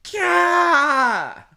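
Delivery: loud, high frustrated cry; frustrated yell. Real, not acted. Recalling the drunk ex's calls, frustrated cry. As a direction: let out an exasperated yell.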